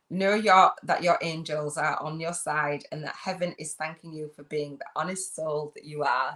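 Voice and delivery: normal talking voice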